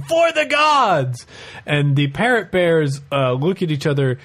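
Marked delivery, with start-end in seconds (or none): 0.0-1.3 replaying the war cry, high and slow; retold battle cry. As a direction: shout it again for the bears.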